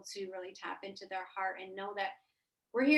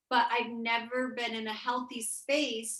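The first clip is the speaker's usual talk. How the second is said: normal talking voice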